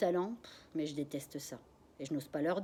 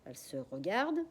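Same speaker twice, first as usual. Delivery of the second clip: ordinary delivery, nothing marked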